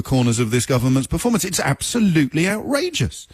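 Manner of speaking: indignant protest. Retold or imitated. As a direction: voice the aggrieved public scolding the press, pitch jumping up. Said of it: "they say to the editors of".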